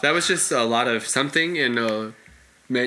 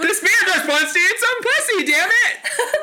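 much higher, laughing exclamation; laughing outburst. Real, not acted. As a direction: blurt it out through laughter. Exasperated outburst while laughing.

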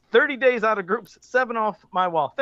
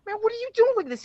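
shocked; 13 semitones higher, faster than usual. Retold or imitated. Retelling shock at nephew's video.

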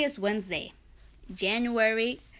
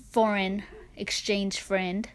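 ordinary talk

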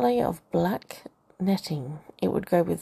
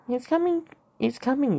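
sing-song tease; 6 semitones higher than usual. Acted. Ebony teasing sing-song.

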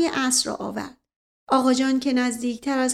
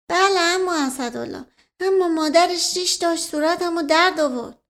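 put-on higher-pitched simple girl voice; innocent Qamar; 6 semitones higher, 4 dB louder than usual. A narrator's reading. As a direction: guileless complaint in a put-on girlish voice. Simple girl answering her uncle.

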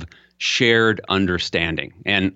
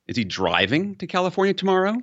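"California" lifted high in a rising question; surprised question peaking on California; stress on California; 9 semitones higher than usual. Shown as a demonstration. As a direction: sweep the voice up on "California". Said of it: "I've said that sentence four times".

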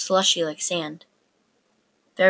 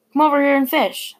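higher, firm order; commanding; 7 semitones higher, 4 dB louder than usual. A narrator's reading. Big sibling orders sister over, commanding. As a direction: order her over like a boss. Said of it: "I said commandingly".

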